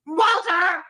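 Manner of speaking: hammed-up screech for the husband; yelling Walter. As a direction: call him out at full volume. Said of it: "She did yell, "Walter!".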